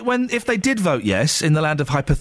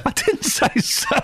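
mock high squeak; 12 semitones higher, slower than usual.

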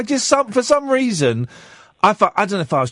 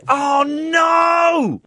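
high shouted cry of dismay; horrified shout; 12 semitones higher than usual. Retold or imitated. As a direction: yell in mock horror. Realising it was Coldplay.

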